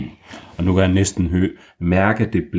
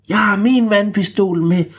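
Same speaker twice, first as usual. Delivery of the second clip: high-pitched eager call, a bit louder; high excited shout; 13 semitones higher, 5 dB louder than usual. Acted. Kaj calling out to Bamse, high excited shout.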